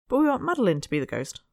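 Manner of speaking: put-on a young girl pleading her case; eager child. Young Miss Seabright insisting to the grown-ups, eager child.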